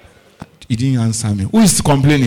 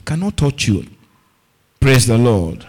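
ordinary talk

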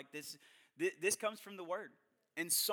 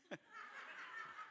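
laugh; 17 dB quieter than usual. Real, not acted. Laughs at own boast.

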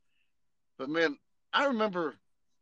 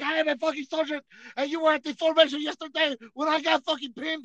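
higher, heated mock protest; indignant insistence; 12 semitones higher than usual. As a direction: insist hotly. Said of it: "this motherfucker's like".

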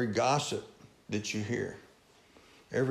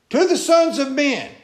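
rising cry; 9 semitones higher, 5 dB louder than usual. Acted. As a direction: voice climbs high and loud on sons of men.

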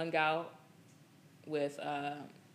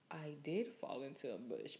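softer, sheepish realization; dawning admission; 2 semitones lower, 9 dB quieter than usual. Retold or imitated. Retelling realizing she fell in the bush, sheepish. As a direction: let the truth sink in quietly. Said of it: "my head was like".

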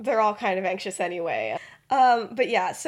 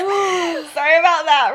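laughing apology; 8 semitones higher, 12 dB louder, slower than usual. Real, not acted.

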